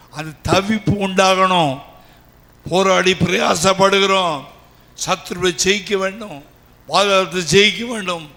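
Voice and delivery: loud, driving sermon delivery; fervent preaching. Real, not acted. Preacher urging the congregation to overcome, loud fervent preaching. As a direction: preach it forcefully in ringing declarations.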